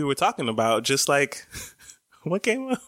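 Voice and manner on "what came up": laughing. Real, not acted. Laughing mid-question, far.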